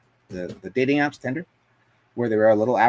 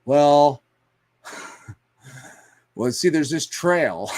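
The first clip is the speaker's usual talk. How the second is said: sheepish son voice; 3 semitones higher, 3 dB louder, slower than usual. Retold or imitated. Son sheepishly explaining himself.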